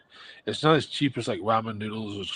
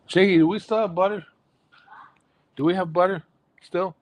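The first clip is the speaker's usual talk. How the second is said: higher, louder call across the house; 9 semitones higher, 7 dB louder than usual. Real, not acted. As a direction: call out to the kitchen. Calling to wife in kitchen.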